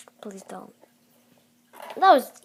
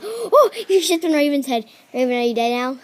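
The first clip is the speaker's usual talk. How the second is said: ordinary delivery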